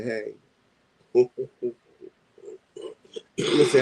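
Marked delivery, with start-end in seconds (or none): 0.0-0.3 low, softer dry hey; flat low hey. Retold or imitated. Husband greets someone, curt and low. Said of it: "Joseph's gonna say".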